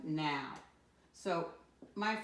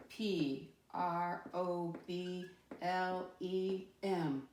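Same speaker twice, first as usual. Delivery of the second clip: slow spelling; much slower than usual. Shown as a demonstration. Teacher spells word for class.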